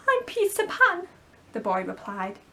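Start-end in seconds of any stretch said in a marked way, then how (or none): 0.1-1.1 put-on higher boyish voice; a bright young boy introducing himself; Peter Pan voice.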